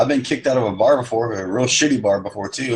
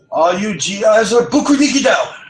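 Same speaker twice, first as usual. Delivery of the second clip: high, loud mock Vietnamese accent; hammed-up Vietnamese bar-girl accent; a hammed-up Vietnamese bar girl calling to the GIs; 13 semitones higher, 5 dB louder than usual. Imitating a Saigon bar girl.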